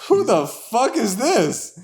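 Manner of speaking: imitating a startled shout, stretched out; mock yell. Caller retelling how he yelled at the imposter on the phone, a slow mock yell. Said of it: "And I'm like".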